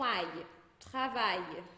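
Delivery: "aille" and "travail" ending in a Y glide; L como I. Shown as a demonstration. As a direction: say "travail" as French "tra-vai", no L. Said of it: "Outros exemplos". ("travail" em francês, L final soando I)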